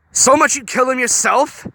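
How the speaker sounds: high, loud disbelief; can't believe what she's hearing; incredulous demand. A narrator's reading. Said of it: "Gogo demanded incredulously".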